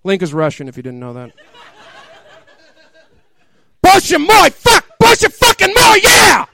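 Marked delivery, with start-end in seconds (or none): 3.8-6.5 very high, loud screaming in mock ecstasy; even higher, all-out mock shriek; mock climax shout. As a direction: scream it to the heavens. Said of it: "she shouted into the heavens".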